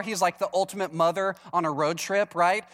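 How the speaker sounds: ordinary talk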